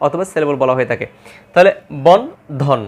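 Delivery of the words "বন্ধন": slow demonstration. Shown as a demonstration. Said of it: "দুইবারে উচ্চারণ হচ্ছে".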